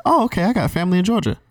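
high, quick chirpy imitation; imitated stranger. Retelling a reply about family in Georgia, high imitated stranger. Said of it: "It was like".

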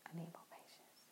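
hushed whisper to yourself; barely-there confessional whisper; whispered admission. Real, not acted. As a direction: drop to a soft whisper, owning up. Admitting a fault to self.

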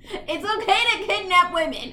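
loud sarcastic echo; mock outrage. Echoes friend, mocking villain.

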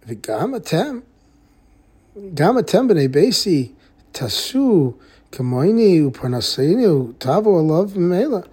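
ordinary talk, nothing marked

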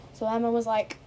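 plain reading voice

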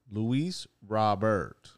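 very slow "Robert" as ROB-ert; demonstrated pronunciation. Shown as a demonstration. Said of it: "Luis Robert's name is pronounced".

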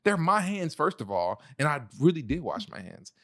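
high, angry mock rant; retold anger. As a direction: vent like a furious partner being accused. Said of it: "I was so mad".

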